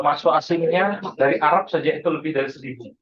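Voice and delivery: normal talking voice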